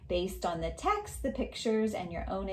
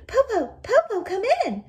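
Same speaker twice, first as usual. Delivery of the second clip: put-on much higher, louder children's cry; excited shout; 12 dB louder than usual. Children eagerly shouting for granny to come in, loud and high. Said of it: "They shouted".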